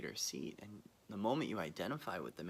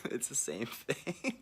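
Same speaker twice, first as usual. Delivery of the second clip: laughing; 5 semitones higher, 6 dB louder, slower than usual. Real, not acted. Laughing at own joke.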